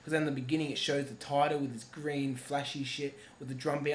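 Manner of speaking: usual speaking voice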